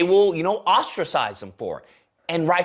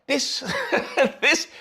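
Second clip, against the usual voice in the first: laughing in disbelief; incredulous laugh; 6 semitones higher than usual. Real, not acted. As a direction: breaks into a laugh mid-word.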